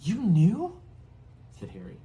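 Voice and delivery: high, loud disbelieving questions; stunned, rising in disbelief; shocked boy. Acted. Boy asks his relatives in shocked disbelief.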